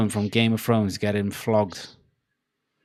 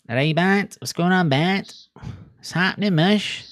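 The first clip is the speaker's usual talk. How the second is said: higher, lilting Welsh Valleys accent; 6 semitones higher, 3 dB louder than usual. Shown as a demonstration. Streamer mimicking Nessa-type greeting, Valleys accent. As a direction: greet a pal in broad Valleys sing-song. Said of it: "like, the Valley's accent".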